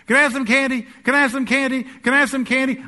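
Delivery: put-on high, pleading child voice, repeated; whiny toddler. Preacher voicing a toddler begging mom for candy, whiny. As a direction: nag like a kid at checkout.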